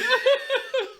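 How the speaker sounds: high, loud burst of laughter. Real, not acted. Joking with his co-host, a high burst of laughter. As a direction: cackle at the joke. Joking about crying and chicken.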